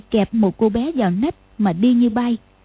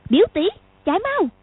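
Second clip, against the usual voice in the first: put-on high, urgent child calling out; little girl yelling to run; 9 semitones higher than usual. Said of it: "Lục Vô Song gọi". (giọng bé gái gọi gấp)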